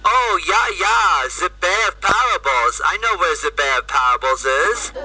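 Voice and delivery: imitated German-accented English; thick German accent. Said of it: "in this thick German accent, he says".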